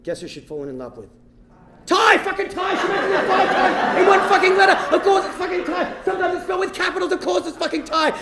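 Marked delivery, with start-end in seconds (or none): 1.9-8.1 high shouted comic fury; outraged rant. Acted. Comedian tells crowd who she left him for, outraged rant. Punchline outburst at the betrayal.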